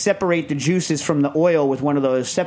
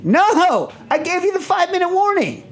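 exasperated shout. Retold or imitated. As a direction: shout it up high, fed up. Exasperated outburst at family.

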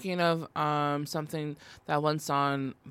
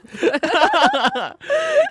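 loud high laugh; 14 semitones higher, 8 dB louder than usual. Real, not acted. Big laugh.

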